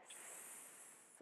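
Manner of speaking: a long hissing "s-"; drawn-out s like a snake. Shown as a demonstration. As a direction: hiss the "s-" out slowly like a snake. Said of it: "like a snake".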